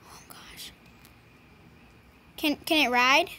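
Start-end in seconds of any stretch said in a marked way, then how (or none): 0.1-0.7 hushed breathy whisper; barely voiced gasp of awe. Real, not acted. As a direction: whisper so no one hears. Sneaking into someone's house.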